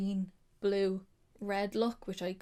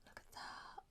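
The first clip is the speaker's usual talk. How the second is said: hushed, breathless look at that; awed whisper; 20 dB quieter than usual. Real, not acted. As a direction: whisper in awe at the colour. Marvelling at dark blue swatch.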